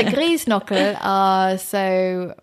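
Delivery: slow, drawn-out Austrian dialect Grießknochel. Shown as a demonstration. Guest names her grandma's dumplings in a self-mocking Austrian accent. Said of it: "my awful Austrian accent".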